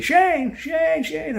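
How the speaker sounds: high-pitched imitation of calling a name. Mother-in-law calling him back from the garage, imitated calling out. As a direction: call out in a high, mimicked voice. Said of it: "I hear her say".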